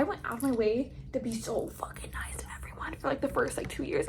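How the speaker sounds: hushed confession; whisper. Real, not acted. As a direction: whisper it like a secret. Unvoiced confession.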